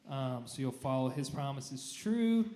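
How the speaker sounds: usual speaking voice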